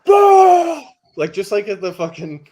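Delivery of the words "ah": very high, loud yell; sudden yell. Retold or imitated. His outburst at the staff, a sudden loud yell. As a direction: let out a sharp loud cry. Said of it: "it was like".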